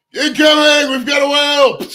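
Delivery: high, full-voiced shouted warning; mock alarm shout. Joking about a jet ski outing, mock alarm shout. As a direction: holler a joke warning at the top of your voice.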